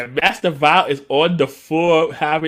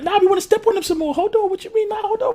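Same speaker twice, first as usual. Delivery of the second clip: much higher, fast, eager little voice; squeaky Delphine voice; 3 dB louder than usual. Retold or imitated. Delphine eager to keep fighting, high squeaky voice. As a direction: pipe it up high and quick like a giddy kid.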